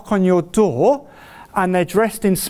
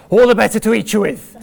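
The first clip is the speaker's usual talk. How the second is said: fast, louder wolf voice; big bad wolf voice; 4 dB louder, faster than usual. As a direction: snap it out like the wolf. Wolf's line in fairy tale.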